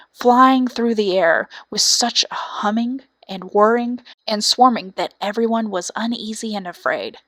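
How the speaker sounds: plain reading voice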